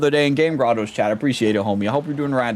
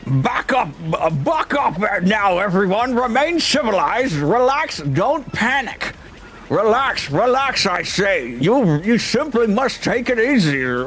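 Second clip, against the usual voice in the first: higher, mock-calm fussy merchant; prim merchant; 5 semitones higher than usual. Merchant calming panicking passengers, prim and fussy. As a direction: soothe the crowd with prissy authority.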